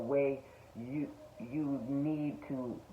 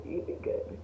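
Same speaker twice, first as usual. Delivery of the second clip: breathy whispered "you'd be good"; whispered repeat; 7 dB quieter than usual. Real, not acted. Reassuring viewers who struggle, in a soft whisper.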